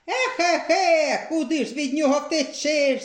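повільне старече зітхання, вище; безнадійно зітхає; зітхаючи. A narrator's reading. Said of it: "зітхнув Дід Мороз". (Дід Мороз зітхає повільно, по-старечому)

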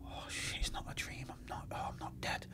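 hushed breathy whisper of disbelief; a dazed whisper on waking up alive. Retold or imitated. Waking in hospital, retold thought.

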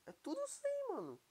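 amazed repeat. Real, not acted. Gamer marvelling at maxed-out armour, excited and high-pitched.

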